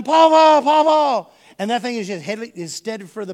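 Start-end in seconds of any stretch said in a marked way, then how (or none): 0.0-1.3 loud, high panicked calls; yelling for grandpa. Retold or imitated. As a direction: yell for help as the tiller drags you. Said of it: "I'm yelling".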